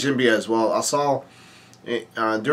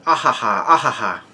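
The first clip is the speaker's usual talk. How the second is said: mock "ah ha ha" laugh; put-on staccato laughing; acted laugh. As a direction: voice a deliberate, spelled-out character laugh. Voicing the snakehead's laugh.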